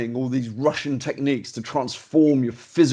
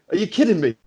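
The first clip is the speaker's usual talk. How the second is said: disbelieving, pitched up; incredulous; 6 semitones higher, faster than usual. Retold or imitated. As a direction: throw the line up in disbelief. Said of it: "I was so angry".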